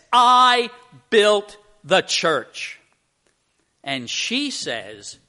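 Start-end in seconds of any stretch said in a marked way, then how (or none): 0.1-2.8 loud, high and slow, stretching every word; proud claim. Acted. Builder tells the Mother Superior he built it, loud and proud. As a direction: boast it to the nun, drawn out.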